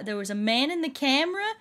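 ordinary talk, nothing marked

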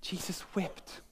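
very soft, almost whispered; barely voiced and reverent; hushed grief. Real, not acted. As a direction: let it fall to a solemn hush. Solemn repeat after news of a death.

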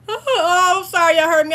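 high, loud embarrassed outburst; flustered apology. Real, not acted. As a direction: apologize loudly, fresh from a laughing fit. Apologizing straight out of laughing fit.

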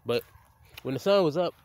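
ordinary delivery

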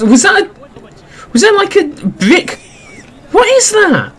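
high-pitched, incredulous questioning; what on earth is that noise; baffled disbelief. Real, not acted. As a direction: voice shoots up in bewilderment. Baffled by strange noise in game.

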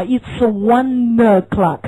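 slow "It's one o'clock" with words run together; linked reading. Shown as a demonstration. Teacher modelling linking in "It's one o'clock". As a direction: link "one" into "o'clock", slowly. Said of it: "连读啊".

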